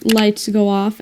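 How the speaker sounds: usual speaking voice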